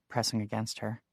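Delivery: ordinary delivery, nothing marked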